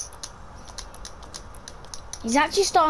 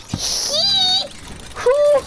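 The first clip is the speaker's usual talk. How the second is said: high-pitched playful whoops; silly high yelps. Pretend. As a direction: squeal it out for fun.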